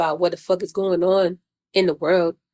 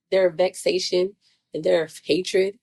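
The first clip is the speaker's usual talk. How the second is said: normal talking voice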